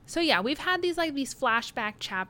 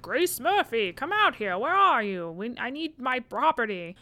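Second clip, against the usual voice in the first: loud megaphone shout, higher; through a megaphone; 4 semitones higher, 5 dB louder than usual. Retold or imitated. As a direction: bellow it through a bullhorn. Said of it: "shouting into a megaphone".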